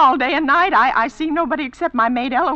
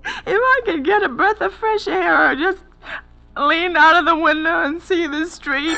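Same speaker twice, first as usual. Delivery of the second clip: ordinary talk, nothing marked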